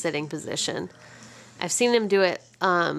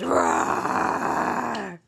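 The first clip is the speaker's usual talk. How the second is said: tiger roar. Pretend. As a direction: growl it like a toy tiger. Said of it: "He goes".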